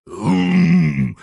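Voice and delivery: loud bear grunt; roaring grunt. Acted. The bear roars back at grandfather. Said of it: "заревел медведь".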